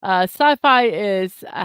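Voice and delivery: normal talking voice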